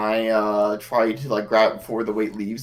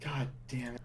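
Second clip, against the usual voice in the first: muttered curse; 16 dB quieter than usual. Real, not acted.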